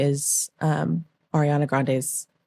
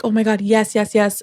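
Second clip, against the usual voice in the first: retold excited yes, higher pitch; excited acceptance; 5 semitones higher than usual. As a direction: blurt it like a thrilled acceptance. Retells accepting the dream job.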